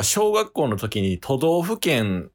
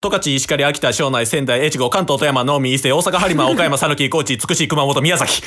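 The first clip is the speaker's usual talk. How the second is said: rapid-fire recitation; 4 semitones higher, 3 dB louder than usual. Shown as a demonstration. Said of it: "披露していいっすか？".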